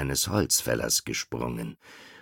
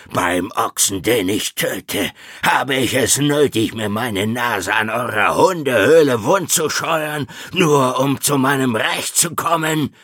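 loud, high, raging tiger; roaring tiger; 10 semitones higher, 8 dB louder than usual. Acted. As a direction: roar it out with slowing boast. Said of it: "Der Tiger brüllte". (brüllender, wütender Tiger)

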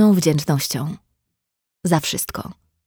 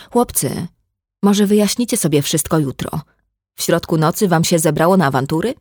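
ordinary delivery

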